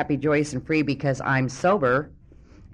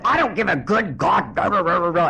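loud, higher angry rant dissolving into gibberish; mock screaming father; 5 semitones higher, 7 dB louder than usual. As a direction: imitate dad ranting his head off. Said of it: "he's screaming his head off".

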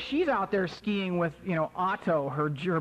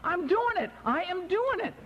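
high, jubilant disbelief; amazed excitement; 12 semitones higher than usual. Retold or imitated. Skier finally staying on his feet, amazed and excited. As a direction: exclaim it in a high, thrilled voice. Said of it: "And I couldn't believe it".

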